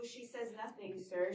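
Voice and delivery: normal talking voice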